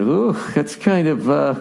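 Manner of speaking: slow, hesitant mock anchor unease; awkward news anchor. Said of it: "and they kinda do that".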